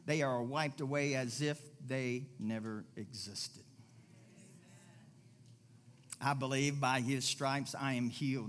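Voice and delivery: low, hushed prayer of faith. Shown as a demonstration. Pastor modelling a prayer for the congregation, low and hushed. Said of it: "Draw it back and say".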